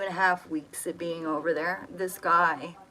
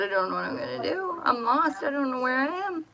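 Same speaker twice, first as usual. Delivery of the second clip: panicked pleading; 8 semitones higher than usual. Retold or imitated.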